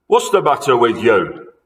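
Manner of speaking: loud grumpy husband; grumpy old man. Acted. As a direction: snap it from the bed. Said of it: "Mister Twit said".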